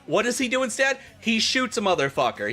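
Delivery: usual speaking voice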